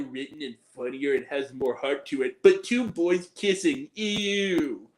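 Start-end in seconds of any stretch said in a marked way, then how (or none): none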